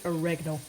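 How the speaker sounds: oregano with the stress on "REG"; American pronunciation. Shown as a demonstration. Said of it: "I find it very hard to say".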